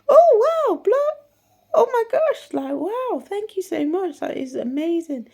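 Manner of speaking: very high, loud shriek of joy; overjoyed. Retold or imitated. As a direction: squeal thanks down the phone. Overjoyed reaction on phone.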